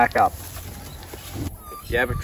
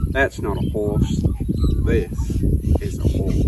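mock Aussie accent; slower than usual. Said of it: "critique my Aussie accent".

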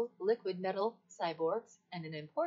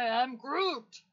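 slow, loud, emphatic Groot, pitched up; Groot impression; 4 semitones higher, 5 dB louder, slower than usual. Shown as a demonstration. Assistant topping its own impression, loud emphatic Groot. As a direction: proclaim it big and slow as Groot. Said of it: "Do another impression".

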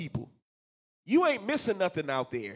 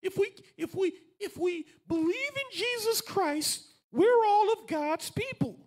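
very high, put-on believer voice; mocking everybody; 12 semitones higher than usual. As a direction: mimic naive believers in a high-pitched voice. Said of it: "Because everybody says".

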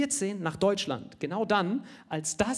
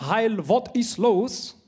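slow, drawn-out mock Nazi line, louder; mock comic Nazi; 5 semitones higher, 5 dB louder, much slower than usual. Comedian reading an old comic-book Nazi line to the audience, slow and hammed-up. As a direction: read it out like a silly comic speech bubble.

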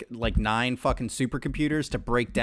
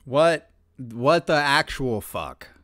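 stunned slow; slower than usual. Real, not acted.

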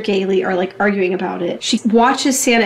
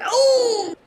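high, drawn-out "oh"; acted high cry of pain; mock pained yelp; 12 semitones higher, 3 dB quieter than usual. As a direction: yelp as if your beard is tugged. Santa's beard gets pulled.

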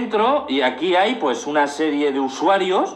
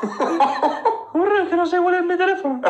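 high falsetto, fast and giggly; squeaky fake girl voice; man doing a woman's voice; 13 semitones higher, faster than usual. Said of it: "no sé hacer voz de mujer".

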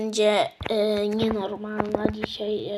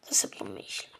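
whispering; 12 dB quieter, much faster than usual. Real, not acted. Muttered to self.

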